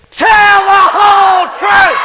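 very high, loud, slow shout; full shout. Real, not acted. Shouting the chant with the whole children's group. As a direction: yell it as loud as you can. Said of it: "as loud as we possibly can".

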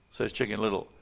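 ordinary delivery

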